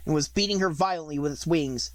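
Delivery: ordinary delivery, nothing marked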